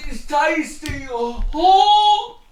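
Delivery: high, slow, loud gush of delight; gushing train fan. Pretend. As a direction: swoon like a thrilled trainspotter. Continuing the train fan impression.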